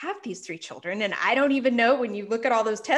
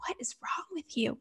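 baffled whisper; 7 semitones higher, 5 dB quieter than usual. Retold or imitated. Husband's baffled question.

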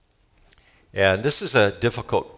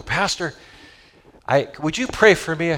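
higher, timid plea to the pastor; 9 semitones higher, 3 dB louder than usual. Retold or imitated. Young believer asking pastor for prayer, higher timid voice. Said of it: "young Christians go".

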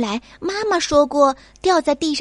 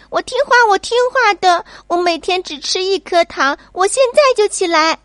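much higher and louder, hurried desperate promises; a frightened child promising to behave; pleading child; 8 semitones higher, 4 dB louder than usual. Acted. Child begs ants, panicked. (惊慌的孩子，急促高声保证)

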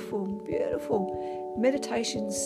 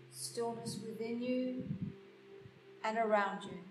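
guided meditation; 8 dB quieter than usual. Acted. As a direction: guide them gently into stillness. Leads a meditation.

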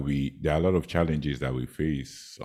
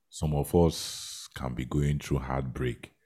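ordinary talk

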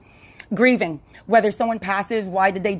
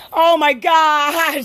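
high-pitched, long drawn-out exclamation; overjoyed excitement; 6 semitones higher, 4 dB louder, much slower than usual. Real, not acted. Podcaster too excited about the verdict, drawn-out elated cry. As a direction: stretch it out slowly, overcome with elation. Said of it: "I'm too excited about that".

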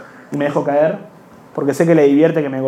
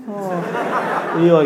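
ordinary talk, nothing marked

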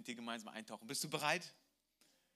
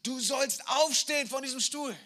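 much higher, louder furious yell; father's shout; 9 semitones higher, 10 dB louder than usual. Acted. Father screams at his tied son to get up. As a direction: scream the order at him. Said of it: "schreit ihn an".

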